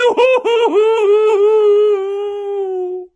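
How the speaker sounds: very high, drawn-out hooting laugh; shrieking cackle. A narrator's reading. Peeves flying off from Filch, shrieking cackle. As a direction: let a wild laugh trail away.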